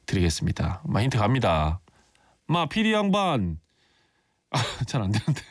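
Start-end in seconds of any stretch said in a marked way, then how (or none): 0.8-3.6 부산 사투리로 천천히 능청스럽게; Busan dialect. Shown as a demonstration. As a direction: lay on the Busan accent for the PD. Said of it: "다시 한번 부산 사투리로 힌트 한번".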